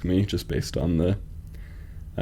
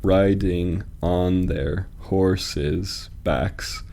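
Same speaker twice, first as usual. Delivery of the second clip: very slow beat-by-beat reading, landing on "backs"; slow scansion with "backs" as a stressed final beat; much slower than usual. Shown as a demonstration. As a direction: space out each beat and set "backs" apart with its own stress. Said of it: "you at least get the emphasis on the word backs".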